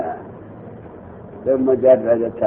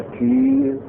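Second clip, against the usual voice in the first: high, drawn-out exclamation of Turkish amazement; imitated surprise; 10 semitones higher than usual. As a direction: mimic a Turk's astonished cry, held high.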